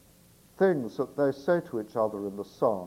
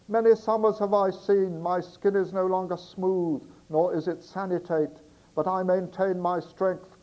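Barnacle Bill voice; 5 semitones higher than usual. Acted. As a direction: shout it up from the street like a rough seaman. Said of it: "the Barnacle Bill voice for Barnacle Bill".

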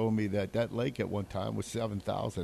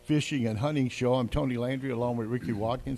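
morning show host; 5 dB louder than usual. Acted. Opens the radio show.

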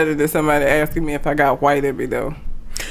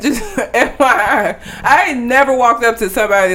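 ordinary talk

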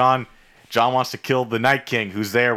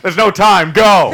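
louder, high-pitched mock urgent command; urgent shout; 8 semitones higher, 8 dB louder, slower than usual. Uncle zombie ordering Jon to flee, urgent shout. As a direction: shout it like there's no time to lose.

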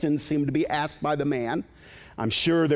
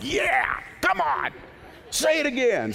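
excited cheering; 11 semitones higher than usual. Retold or imitated. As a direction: cheer them on with glee. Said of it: "I'm like".

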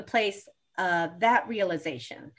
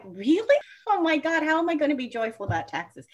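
disbelief; 7 semitones higher, 3 dB louder than usual. Retold or imitated. Retold incredulous reaction to forecast.

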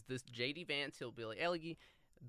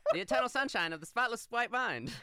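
higher, louder newsreel announcer; 1940s announcer voice; 10 semitones higher, 8 dB louder than usual. Pretend. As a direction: announce it like an old radio bulletin. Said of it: "nineteen forties announcer voice".

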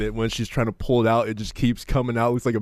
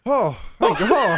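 mock relief; 10 semitones higher, 4 dB louder, slower than usual.

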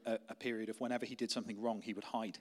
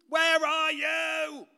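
very high, drawn-out yell; imitated child's loud singsong call; child shouting searchingly; 9 dB louder, much slower than usual. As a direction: holler it slowly like a kid hunting for dad. Child yelling through the house, acted.

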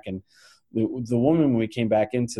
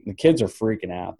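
ordinary talk, nothing marked

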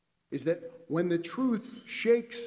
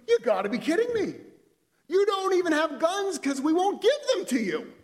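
much higher and louder, indignant blast; outraged retort; 13 semitones higher, 8 dB louder than usual. Acted. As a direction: explode in disbelief before storming out. Friend storms out in outrage.

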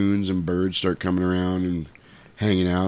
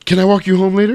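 higher, louder eager question; eager suitor; 10 semitones higher, 7 dB louder, faster than usual. Retold or imitated. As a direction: ask her out in a raised voice. Acting young Rudolph asking Clarice out.

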